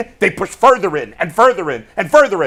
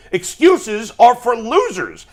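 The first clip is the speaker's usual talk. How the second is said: slow, higher, emphatic put-down; meaner; 4 semitones higher, slower than usual. Shown as a demonstration. Host repeating his colleague's line about losers, meaner. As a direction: say it meaner, drawing out each word. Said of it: "I'm gonna say it in a meaner way".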